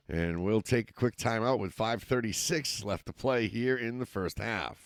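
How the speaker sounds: steady live football call, rising with the action; play-by-play commentary. Acted. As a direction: call the game as it happens. Play-by-play resumes.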